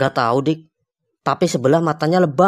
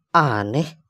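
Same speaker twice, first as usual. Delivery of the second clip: ordinary talk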